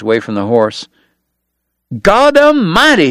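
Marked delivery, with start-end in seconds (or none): 2.0-3.1 high, loud, slow shout of alarm; alarmed cry. Acted. After near kick, Doc cries out in high alarm. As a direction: cry out in fright. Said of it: "he cried".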